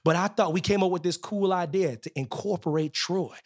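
usual speaking voice

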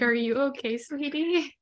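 high, loud sweet mom. Retold or imitated. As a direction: coo it like a doting mother. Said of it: "Yeah, like".

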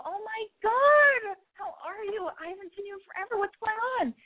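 high, overexcited squeal of a greeting; a hammed-up high Oh my God, then fake-bright chatter; mock gushing greeting. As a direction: gush at him like a long-lost friend. [name] greets ex, acted gushing.

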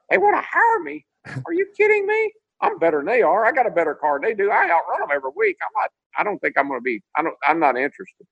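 mock outrage that they'd even ask; incredulous.

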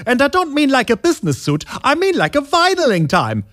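cheesy late-night ad read, over the top; infomercial pitch. Acted. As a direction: sell it like a TV pitchman.

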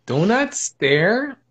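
very slow, every word spaced out; firm warning. Retold or imitated. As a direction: lay down the rule slowly. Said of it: "a little pep talk".

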